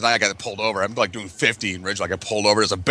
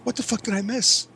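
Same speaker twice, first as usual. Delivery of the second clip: high baffled question; mock disbelief; 11 semitones higher, 3 dB quieter than usual. Imitating his stunned reaction, pitched high.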